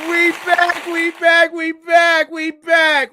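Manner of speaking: high, loud hype shout; rowdy party animal. Real, not acted. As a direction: shout it like a triumphant return.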